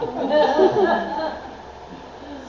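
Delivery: real burst of laughter. Real, not acted. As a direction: laugh out at your own joke. Laughing at own joke.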